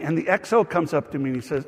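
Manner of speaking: normal talking voice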